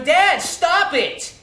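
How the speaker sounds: a son telling his father to stop, in a retold outburst; angry plea. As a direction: drag it out, pleading and loud. Son begs father at dinner.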